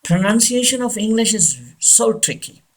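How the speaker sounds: ordinary talk, nothing marked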